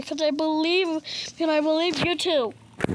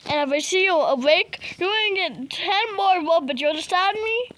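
normal talking voice

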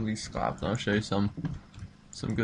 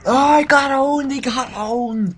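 much higher and louder triumphant yell; gloating shout; 13 semitones higher, 13 dB louder than usual. Real, not acted. Gamer gloating at an enemy he just killed, yelling. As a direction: crow over the kill.

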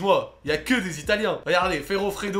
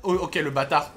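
ordinary delivery

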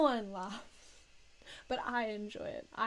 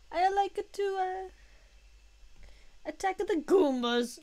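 high, loud hammy Mario voice; Chris Pratt Mario; 9 semitones higher, 9 dB louder than usual. Retold or imitated. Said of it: "trying to do the Chris Pratt".

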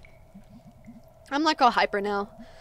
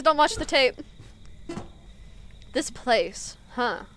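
ordinary talk

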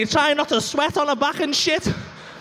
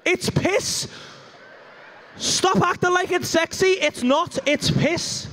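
ordinary talk

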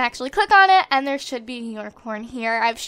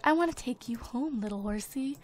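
hushed, softer murmur to a horse; mock tender whisper to the horse; soft cooing; 10 dB quieter than usual. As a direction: coax the horse softly. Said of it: "She's, like, whispering to it".

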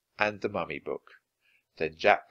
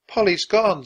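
high, louder touch of sadness; mildly sad; 9 semitones higher, 9 dB louder than usual. A narrator's reading. Annie misses the parrot, mildly sad. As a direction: lament it lightly. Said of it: "said Annie, sadly".

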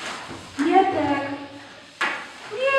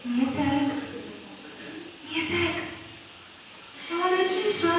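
a much softer, hushed calling; listen into the silence softly; hushed calling in the dark tent; 4 semitones lower, 11 dB quieter than usual. Acted.

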